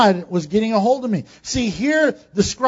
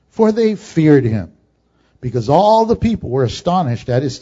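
scripture reading; 6 semitones lower, 3 dB louder than usual. Acted. Preacher reading the verse to his congregation, measured.

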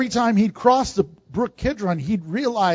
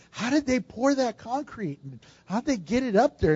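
amazed wondering; 3 semitones higher, 7 dB quieter than usual. Retold or imitated. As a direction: voice an awed thought under your breath. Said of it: "It's amazing. And I think".

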